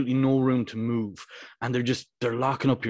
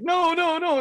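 much higher, louder panicked no; mock panicked protest; 3 dB louder, slower than usual. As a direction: squeal it like a horrified corner. Coach protesting the foul.

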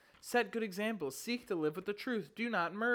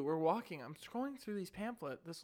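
ordinary talk, nothing marked

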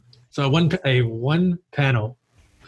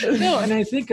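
laughing; 9 semitones higher than usual. Real, not acted. Recovering from laughter.

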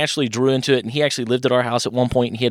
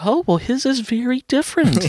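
very high-pitched, tactful surprise; sweet mom. Retold or imitated. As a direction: react politely as a sweet mother. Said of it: "the sweetest lady ever".

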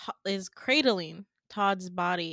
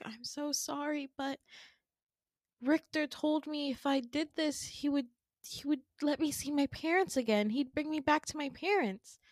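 high, small pleading voice; pleading apology; 6 semitones higher, 4 dB quieter than usual. Retold or imitated. Peach explaining her betrayal to her friends, pleading. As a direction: act out a pitiful, high-pitched excuse. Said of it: "Peach responds and she's like".

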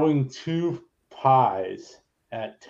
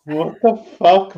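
sudden loud, higher burst of disbelief; exasperated outburst; 7 semitones higher, 9 dB louder than usual. Real, not acted. Podcast host fed up with the Toni Storm booking, exasperated loud outburst. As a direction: blurt it out, fed up.

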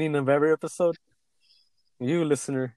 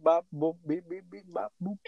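beeping song; 5 semitones higher, 6 dB quieter than usual. Retold or imitated. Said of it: "that one song that goes beep".

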